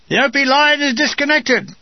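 high, loud shout into the sleeping tent; shouted alarm in the night; hurled cry. Acted. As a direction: yell the alarm to wake everyone. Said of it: "a cry is hurled".

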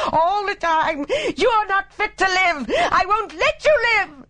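raving, very high-pitched hysterical fury; high, screaming rant. A narrator's reading. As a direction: scream accusations in a high, unhinged voice. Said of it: "raving in a high, screaming voice".

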